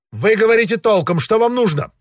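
high, quick, impatient official; haughty high-pitched impatience; peacock voice. A narrator's reading. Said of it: "сказал он павлиньим голосом".

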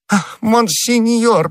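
high, drawn-out adoring cry; grovelling awe. A narrator's reading. Prisoner throwing himself at the cardinal's feet, grovelling awe. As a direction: swoon over the great man, slowly. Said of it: "бросаясь к ногам его высокопреосвященства".

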